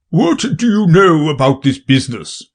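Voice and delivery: the king. A narrator's reading. King questions Alice at trial.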